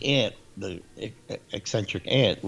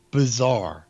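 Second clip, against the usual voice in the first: normal talking voice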